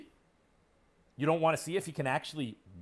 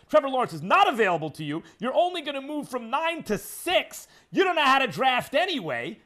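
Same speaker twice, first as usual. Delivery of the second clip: much higher, loud angry rant; louder and higher exasperated rant; 10 semitones higher, 8 dB louder than usual. Real, not acted. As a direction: tear into the front office. Ranting at the Eagles front office.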